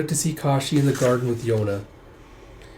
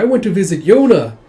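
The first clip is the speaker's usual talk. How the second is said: put-on high, louder eager child; little boy voice; 7 semitones higher, 9 dB louder than usual. Young son tells mother of visit, eager child. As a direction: pipe up like a small boy.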